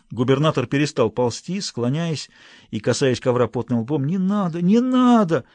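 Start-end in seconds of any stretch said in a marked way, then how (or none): 4.1-5.5 begging wail. A narrator's reading. Governor begging in story.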